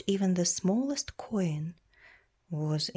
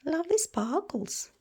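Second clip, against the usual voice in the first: higher, admiring coo; delighted; 10 semitones higher than usual. Acted. Reader admiring the glittering frost, delighted. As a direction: marvel at the sparkle.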